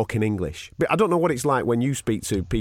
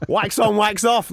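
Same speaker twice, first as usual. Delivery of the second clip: put-on Karate Kid quote; 9 semitones higher, 4 dB louder, slower than usual. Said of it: "Danielson from The Karate Kid".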